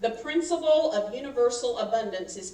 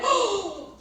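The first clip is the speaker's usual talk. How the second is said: surprised oh; 10 semitones higher, 4 dB louder than usual. Retold or imitated. Reacting to dad's remark.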